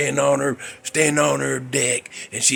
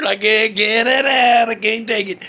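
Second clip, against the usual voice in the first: mock Reba yelling; 7 semitones higher, 6 dB louder than usual. Imitating Reba yelling from her deck, hammed-up holler. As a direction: belt it like a neighbor hollering over the fence. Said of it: "all I hear is".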